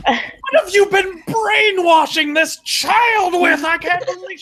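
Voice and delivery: high, slow mock shout of outrage; a hammed-up high-pitched teacher shriek; mock shocked yell. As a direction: play the appalled teacher yelling it out. Teacher yelling at kids, retold.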